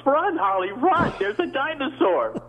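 high-pitched cry of alarm; hammed-up dinosaur-chase shout; panicked TV hero yell. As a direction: yell a melodramatic warning, voice way up. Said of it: "my entire performance on Land of the Lost".

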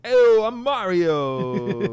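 slow, loud, high put-on Mario voice; drawn-out mock Italian plumber. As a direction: ham up a cartoon plumber. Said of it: "the best Mario voice".

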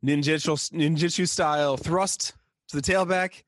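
lightly voiced referee announcing a penalty; imitated referee. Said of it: "the ref is like".

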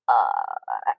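long, very low stalling "uh"; tongue-tied hesitation. Retold or imitated. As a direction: imitate being lost for words. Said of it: "tongue-tied".

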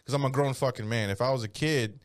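usual speaking voice

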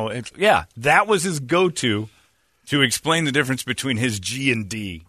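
ordinary talk, nothing marked

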